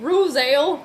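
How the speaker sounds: Rosale said as roh-ZAYL; misread name. Shown as a demonstration. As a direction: read Rosale as a two-syllable roh-ZAYL. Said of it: "spelled three different ways".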